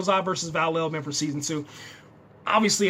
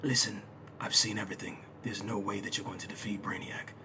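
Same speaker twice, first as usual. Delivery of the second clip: put-on low, hushed old man's voice; Val-El; 9 semitones lower, 12 dB quieter than usual. Old hero warning there's no beating the villain, low hushed voice. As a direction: drop deep and soft, weary elder giving a warning. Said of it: "that's the wrong accent".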